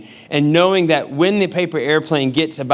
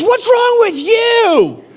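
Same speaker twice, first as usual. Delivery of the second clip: high, loud and drawn-out mock yell; slow imitated screech of an outraged wife; wife's shrieked retort; 5 dB louder, slower than usual. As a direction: stretch it out, throwing it back at him. Wife throws the question back.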